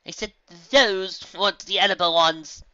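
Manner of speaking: through gritted teeth; tense, teeth clenched. A narrator's reading. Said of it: "Rarity grit her teeth".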